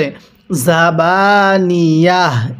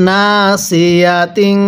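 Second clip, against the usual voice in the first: slow recitation; much slower than usual. Shown as a demonstration. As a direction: stretch নাসিয়াতিন slowly syllable by syllable. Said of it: "তাহলে আমার সাথে পড়ুন". (ধীরে টেনে নাসিয়াতিন)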